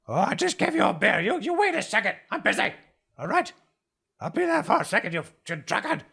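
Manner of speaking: high, hurried tavern keeper brushing off a regular; busy innkeeper. Acted. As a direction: shoo away the drunk regular in a high voice.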